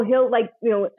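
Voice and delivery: usual speaking voice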